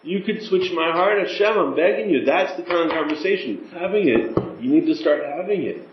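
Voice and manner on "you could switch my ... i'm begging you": higher, urgent begging prayer; a fervent plea for compassion; pleading with Hashem. Shown as a demonstration. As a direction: implore God to change your heart. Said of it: "asking him".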